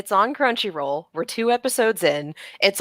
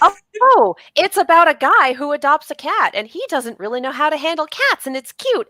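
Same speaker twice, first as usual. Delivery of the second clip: higher, bubbly excitement; excited gushing; 6 semitones higher than usual. Retold or imitated. Viewer gushing over a cute cat show. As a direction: gush like you just found a cute show.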